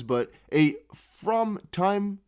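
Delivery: reads it slowly, word by word, puzzled; slow puzzled reading. Shown as a demonstration. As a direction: sound out the garbled phrase slowly. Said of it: "wait, what, how does that".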